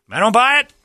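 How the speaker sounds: high whiny I don't buy it, rising on buy; mock whiny fan. Host mocking the fans, whining I don't buy it. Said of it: "Well, they're like".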